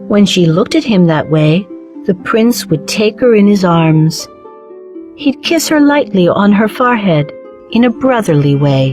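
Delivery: usual speaking voice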